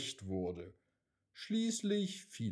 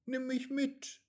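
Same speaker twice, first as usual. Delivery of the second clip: much higher, small pleading voice; eager raindrop; 13 semitones higher than usual. A narrator's reading. Raindrop asks the wind to take it along, eager and high. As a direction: ask brightly like an eager child.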